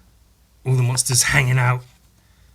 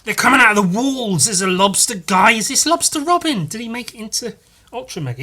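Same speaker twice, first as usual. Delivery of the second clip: 1 panicked character; 9 semitones higher, 6 dB louder than usual. Acted.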